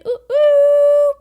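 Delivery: very high, loud ooh; a piercing little cry of delight; excited squeal. Pretend. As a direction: squeal with glee at the treat. Excited squeal over the drink.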